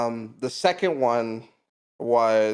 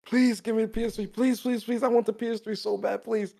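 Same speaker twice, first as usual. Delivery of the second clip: put-on high, whiny pleading kid; mock-whiny pleading for the PS3; childish begging; 8 semitones higher than usual. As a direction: beg like a kid desperate for a gift. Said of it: "I was like".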